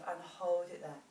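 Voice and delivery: ordinary talk, nothing marked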